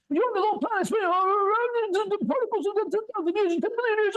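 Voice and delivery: squeaky high mocking voice of the author; mock high quote. Mocking the author's words to a friend, squeaky high. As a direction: parody him in a silly high voice. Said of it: "like he says".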